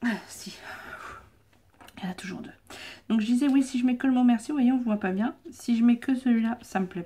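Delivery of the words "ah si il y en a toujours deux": whispering. Real, not acted. Muttering to herself after sneezing.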